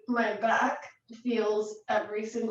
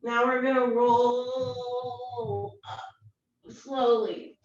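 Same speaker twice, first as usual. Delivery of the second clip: slow instruction; much slower than usual. Shown as a demonstration. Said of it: "yoga instructor cue".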